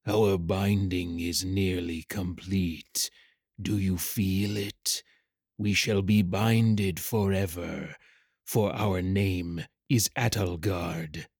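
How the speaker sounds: low, soft, slow creature voice; ominous shadow rider. Acted. Dark rider binds the unwilling hatchling to itself, ominous and slow. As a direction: speak like a dark presence taking possession.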